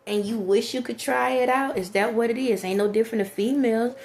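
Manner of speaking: normal talking voice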